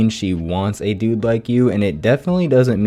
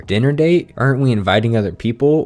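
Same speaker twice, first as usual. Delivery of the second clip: rapid fire; faster than usual. Retold or imitated.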